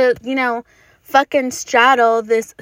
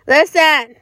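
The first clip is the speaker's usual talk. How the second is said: louder second listen, pushing; emphatic listen; 3 semitones higher, 6 dB louder than usual. Real, not acted. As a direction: raise it to demand attention. Repeats listen, then louder.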